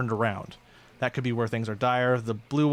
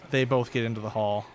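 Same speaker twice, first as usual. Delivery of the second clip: ordinary talk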